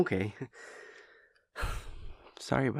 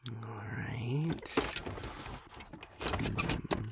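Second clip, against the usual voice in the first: ordinary talk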